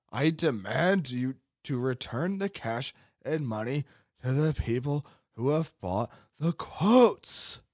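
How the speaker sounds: slow, pompous sweaty incel voice; Dead's sweaty incel voice. Pretend. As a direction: drag it out like a self-important forum troll. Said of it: "read it in your Dead voice".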